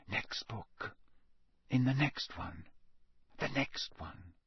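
very soft, half-whispered self-urging; muttering to himself. A narrator's reading. Harry alone searching books late at night, softly muttering. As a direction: barely voice it, just for yourself. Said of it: "tell himself over and over".

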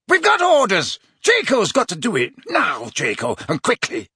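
loud, high shouting; shouted orders. Acted. Death Eater yelling at Draco to act.

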